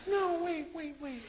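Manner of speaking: mock plea in a woman's pitch; imitated woman pleading. Woman stopping him as he leaves, high imitated pleading.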